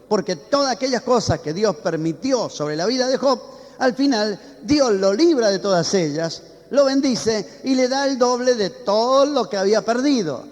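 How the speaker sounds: rally the congregation with rising fervor; preaching at full force. Acted. (predicando con fervor creciente)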